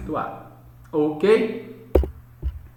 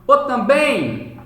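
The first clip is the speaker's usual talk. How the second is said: slow, raised-pitch yell on "também"; pretend shout; 7 semitones higher, 5 dB louder, much slower than usual. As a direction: call out "também" loud and high, stretched. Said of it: "você não vai ficar gritando".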